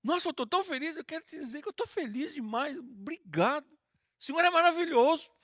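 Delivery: a light, cheerful thank-you to God; happy prayer. Shown as a demonstration. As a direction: show a child's happy prayer. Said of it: "E se você tiver feliz, fala assim".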